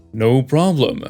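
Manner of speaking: usual speaking voice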